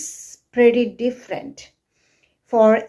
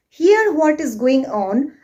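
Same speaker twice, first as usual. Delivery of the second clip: ordinary delivery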